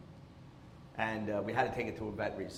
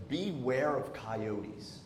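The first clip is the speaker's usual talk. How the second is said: slow sign reading; 3 dB quieter, much slower than usual. Retold or imitated.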